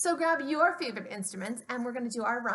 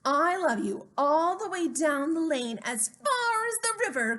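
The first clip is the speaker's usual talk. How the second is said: higher, louder eager little hare; eager cry; 5 semitones higher, 4 dB louder than usual. Acted. Little hare cries his love to father, eager and high. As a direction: call it out far and wide. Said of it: "cried Little Nut Brown Hare".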